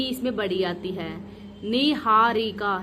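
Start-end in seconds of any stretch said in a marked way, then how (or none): 1.7-2.8 Niharika spelled out slowly, long nee; slow and clear. Shown as a demonstration. Teacher slowly spelling the word out for students. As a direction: stretch each syllable of Niharika. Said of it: "नी बड़ी आती है".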